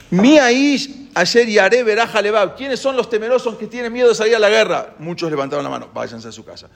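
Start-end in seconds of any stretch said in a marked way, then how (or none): 0.1-4.9 higher, ringing call to the ranks; commander's proclamation. Retold or imitated. Leader proclaiming before troops. 5.9-6.7 much softer, sending him home; quiet dismissal. Retold or imitated. Dismissing the fearful.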